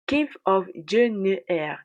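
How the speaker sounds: slow, deliberate English accent on the title. Shown as a demonstration. YouTuber presents the show's title to viewers, in an English accent. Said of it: "Désolé pour mon accent anglais".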